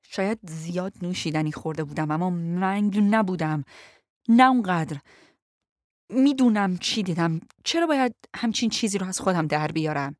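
insistent anger building from restrained to loud; through clenched teeth. A narrator's reading. Passenger insisting she knows what she saw, through clenched teeth. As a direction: force it out through gritted teeth, rising in volume. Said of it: "با دندانهای به هم فشرده گفتم".

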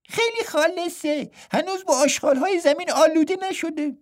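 high, light twin voice finishing his brother's line; a playful twin monk pitched well up. A narrator's reading. (صدای زیر و سبک راهب دوقلو)

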